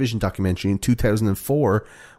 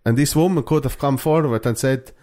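put-on higher-pitched Finnish accent complaint; 3 semitones higher than usual. Host as aggrieved Finnish teen, mock Finnish accent. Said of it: "That's a pretty good Finnish accent".

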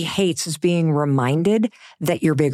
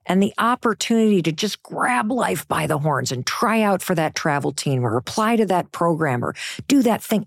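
ordinary talk, nothing marked

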